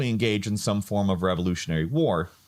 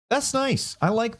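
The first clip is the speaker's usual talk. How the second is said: pleasantly surprised; 13 semitones higher than usual. Real, not acted. Reacting to a friendly reply.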